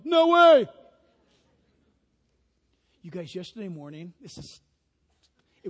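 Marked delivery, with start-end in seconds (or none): 0.0-0.7 loud, higher No way; mock men's refusal. Pastor mimicking men, loud refusal. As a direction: bark it like a stubborn man. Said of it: "Men go".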